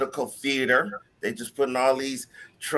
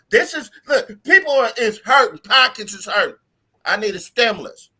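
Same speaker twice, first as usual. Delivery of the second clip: louder, higher, worked-up complaint; loud rant; 6 semitones higher, 7 dB louder than usual. Acted. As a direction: rant like you're fed up. Loud mock rant about stimulus.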